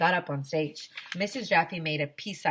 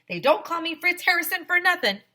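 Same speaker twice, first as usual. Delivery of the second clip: high, loud bragging voice; proud boast, pitched up; boastful handyman; 12 semitones higher, 6 dB louder than usual. Acted. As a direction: show off with a grin. Mr Harrison boasting.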